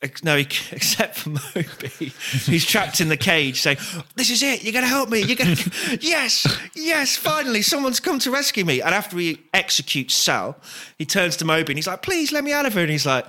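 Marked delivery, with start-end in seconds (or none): 4.1-8.8 high eager pleading voice; hopeful captive. Pretend. Moby trapped, begging rescue. 12.0-12.8 high pleading voice; begging. Pretend. Moby begging.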